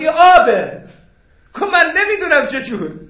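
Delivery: high, loud quoted protest; loud retold exclamation. Teacher quoting the scholar in his everyday example, loudly exclaiming.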